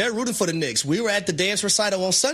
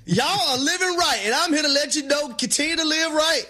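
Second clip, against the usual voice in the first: high, stretched-out church proclamation; mock preaching; 8 semitones higher than usual. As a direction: preach it to the congregation for laughs. Preaches to Knicks fans, bible held.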